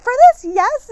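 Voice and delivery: very high and loud yes; shrill-free high loud eager reply; excited friend. Retold or imitated. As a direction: squeal it like she can't wait. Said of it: "She's like".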